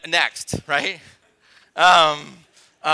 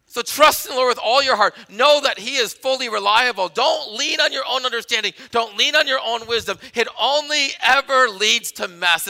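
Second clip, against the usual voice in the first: loud, raised sermon delivery; emphatic preacher, pitched up and punching key words; full-force preaching; 6 semitones higher, 6 dB louder than usual. Real, not acted. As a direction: drive each point home to the congregation.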